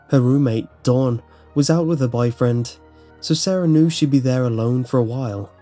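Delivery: ordinary delivery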